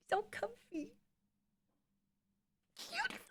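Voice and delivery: very high, soft cooing. Real, not acted. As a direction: squeak softly at the puppy. Cooing at her dog.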